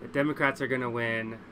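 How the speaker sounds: ordinary talk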